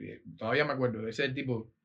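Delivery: ordinary talk, nothing marked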